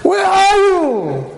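high, drawn-out angry call; flipping out. Acted. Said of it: "about to flip out on his wife".

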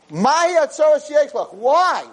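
high, exasperated questioning; exasperated why. Retold or imitated. Father begging the crying boy to stop, high and exasperated. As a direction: rise up high in frustration.

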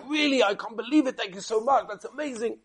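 high-pitched, disbelieving thanks; amazed gratitude. Retold or imitated. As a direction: imitate a tailor overwhelmed by a gift. Tailor overjoyed at unexpected gift.

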